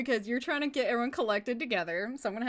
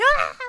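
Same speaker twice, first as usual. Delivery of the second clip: short high, loud mock scream; imitated scream; 12 semitones higher, 11 dB louder than usual. Imitating a video of a guy on skis, mock scream. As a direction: yelp like the skier in the video.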